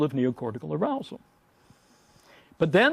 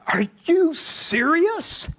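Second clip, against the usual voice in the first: high, slow mock disbelief; incredulous; 12 semitones higher, much slower than usual. Professor answers his dean, high slow incredulous protest. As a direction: stretch it out in outraged disbelief. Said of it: "I said".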